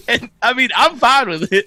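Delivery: high laugh spilling into the words. Real, not acted. Laughing while admitting he dressed up alone. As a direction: laugh it off as you say you're fine.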